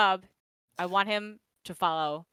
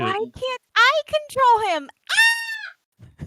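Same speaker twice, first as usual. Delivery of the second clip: frustrated shout; 9 dB louder, much slower than usual. Real, not acted. Player frustrated with token.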